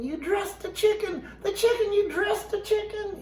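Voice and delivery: high shocked voice. Acted. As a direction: squeal it out in disbelief.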